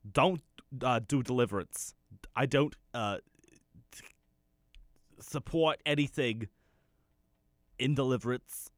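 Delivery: high, loud put-on Northern city voice; mock city accent. Shown as a demonstration. Man translating for city slickers in a loud, high mock Northern accent. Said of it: "I'm gonna do the best to translate that for you".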